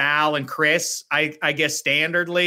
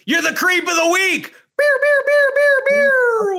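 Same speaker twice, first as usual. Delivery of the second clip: shouted show catchphrase, beer chanted high; announcer catchphrase shout; 6 dB louder than usual. Pretend. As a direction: belt it like a TV segment bumper. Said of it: "let's trademark it".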